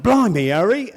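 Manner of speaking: a quick British Blimey; Ron voice. Retold or imitated. Reading Harry Potter to little boys, a British Ron. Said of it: "Ron's like".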